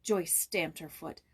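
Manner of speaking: ordinary delivery, nothing marked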